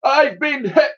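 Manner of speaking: high, loud admiral cry; yell. Acted. Admiral shot in the leg, yell. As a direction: yelp as the bullet strikes. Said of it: "yelled the Admiral".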